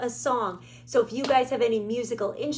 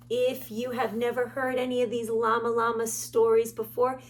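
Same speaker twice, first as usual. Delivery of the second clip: plain reading voice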